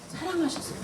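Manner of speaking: quiet answering 사랑하셔서; soft echo. Shown as a demonstration. Preacher answers own question to students, softly. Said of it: "뭐 하셔서?".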